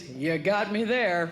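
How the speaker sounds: low, slow dry comeback; deadpan teen boy. Retold or imitated. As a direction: shrug it off like a bored teenager.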